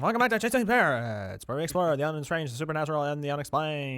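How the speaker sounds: high, loud announcer intro, easing off; radio announcer voice. Pretend. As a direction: welcome listeners like a radio host. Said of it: "announcer voice".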